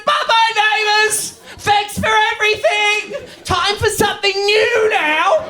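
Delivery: high, slow, drawn-out send-off; hammed-up high farewell cry; shouted mock farewell. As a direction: call it out slowly like a crowd's goodbye.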